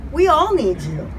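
ordinary talk

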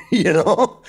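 high, laughing "what?"; laughing along with a buddy in the tree. Real, not acted. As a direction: laugh through the question. Puzzled while partner laughs.

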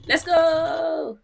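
excited shout. Real, not acted.

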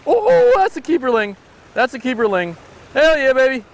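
high-pitched burst of real delight; elated, voice jumping high; thrilled exclamation. Real, not acted. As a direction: celebrate the catch as it surfaces. Sees the keeper fish.

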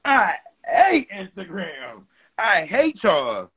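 slow, drawn-out I hate, dragged on the word hate; mock exasperation. Streamer groaning at chat, mock fed up.

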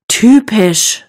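exasperated sigh on "Typisch". A narrator's reading. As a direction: sigh out "Typisch", drawn out and fed up. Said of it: "seufzt Anna". (genervt seufzend)

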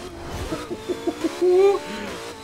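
high-pitched giggly laugh. Real, not acted. Amused during game chat.

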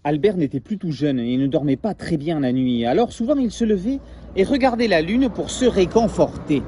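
lively storybook read-aloud; story narration. A narrator's reading. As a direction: tell it like a bedtime tale to children. Reads story to class.

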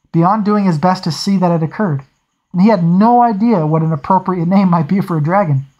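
plain reading voice

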